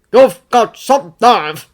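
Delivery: slow, loud seething fury; angry man seething at the returned friend. A narrator's reading. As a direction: push the words out in fury. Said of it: "the man seethes".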